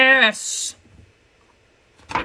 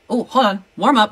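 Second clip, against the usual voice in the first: ordinary talk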